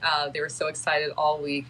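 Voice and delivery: ordinary talk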